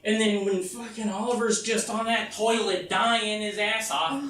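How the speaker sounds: ordinary talk, nothing marked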